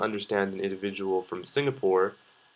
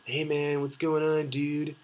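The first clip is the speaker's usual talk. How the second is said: higher-pitched California dude impression; California accent; 6 semitones higher, slower than usual. Showing how people in the States talk, imitated California accent. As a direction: draw up a high California surfer-dude greeting. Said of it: "you've got California".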